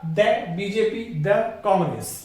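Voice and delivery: 'दे' said short as 'duh' before a consonant; the as duh. Shown as a demonstration. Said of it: "तो ये क्या बोला जाएगा".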